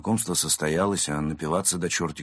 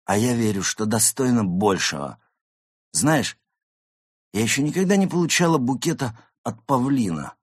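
higher, theatrical longing; yearning grand diva; 6 semitones higher, 5 dB louder than usual. Acted. Actress confiding her dream of a peacock admirer, yearning diva. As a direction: declaim the wish with self-importance.

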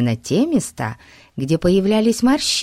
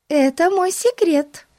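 put-on girl voice; 13 semitones higher than usual. A narrator's reading. Betsy voiced.

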